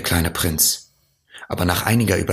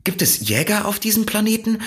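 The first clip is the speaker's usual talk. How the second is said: much higher, eager questioning; excited fox; much faster than usual. A narrator's reading. Fox quizzes the prince about hunters, excited and high. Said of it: "Der Fuchs schien sehr aufgeregt".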